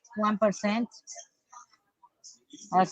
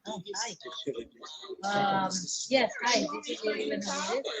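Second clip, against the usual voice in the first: faint, distant phone mic; bluetooth headset mic; 10 dB quieter than usual. Real, not acted. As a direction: sound far off through a headset. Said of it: "it's hard to hear you".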